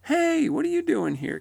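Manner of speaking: high, loud surprised greeting; hammed-up astonished hail; what-are-you-doing-here attitude. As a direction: imitate running into someone unexpected.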